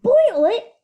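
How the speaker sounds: high, loud shocked cry; gasped. A narrator's reading. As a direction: gasp it in horror. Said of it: "gasped Terrence".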